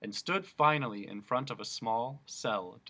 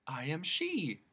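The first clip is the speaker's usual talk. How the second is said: casual; 5 semitones higher, slower than usual. Acted. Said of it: "answered casually".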